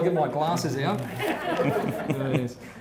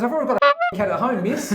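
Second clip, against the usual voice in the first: laughing through punchline; 7 semitones higher, 5 dB louder, faster than usual. Acted. Punchline in kid's voice.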